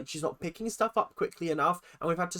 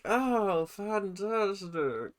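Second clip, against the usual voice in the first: dry sarcastic fantastic; 6 semitones higher, much slower than usual. Acted. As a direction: let "fantastic" sink in slow and dry. Sarcastic praise, quieter repeat.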